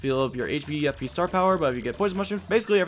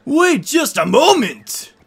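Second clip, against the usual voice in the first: high, loud, drawn-out shout; urgent call; 12 semitones higher, 11 dB louder, much slower than usual. Acted. Professor stopping Mario as he leaves, loud urgent call. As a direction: call after them to stop, stretching it out.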